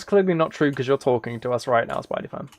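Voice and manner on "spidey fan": ordinary delivery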